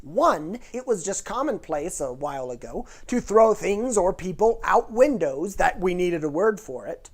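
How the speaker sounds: ordinary talk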